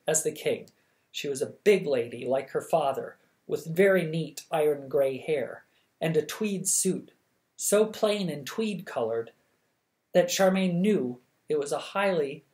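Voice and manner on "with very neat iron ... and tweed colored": usual speaking voice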